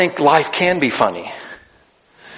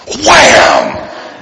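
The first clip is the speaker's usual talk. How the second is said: higher, punchy shouted wham; mock wham; 7 semitones higher than usual. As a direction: shout the hit sound. Kid hitting another kid.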